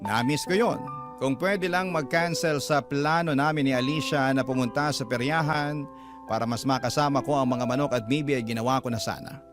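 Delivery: usual speaking voice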